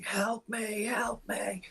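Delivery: high, thin, breathy cry for help; desperate pixie plea. Acted. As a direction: beg frantically like a cornered creature. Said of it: "and just screaming".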